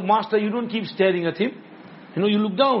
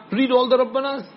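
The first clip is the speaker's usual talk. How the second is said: ordinary talk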